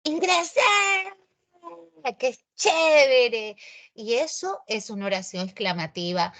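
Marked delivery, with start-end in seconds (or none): 0.0-3.6 high, loud, drawn-out student joy; joyful exclamation. Acted. Teacher acting a student celebrating admission, overjoyed. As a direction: cheer like you just got admitted. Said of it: "es así como lo expresan".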